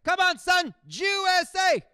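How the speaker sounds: high, loud stadium chant; mock sports-fan shout, slow on the chant; imitating dad yelling. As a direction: bellow it at the TV like a fan. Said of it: "He's yelling at the screen".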